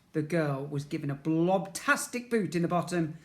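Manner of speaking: ordinary delivery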